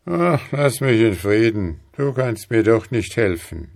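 leicht gehobene, lautere, verzagte Männerstimme; betrübter Mann. A narrator's reading. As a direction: abwinkend und mutlos. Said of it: "antwortete der Mann". (ein Hauch von Verzweiflung)

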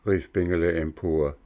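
usual speaking voice

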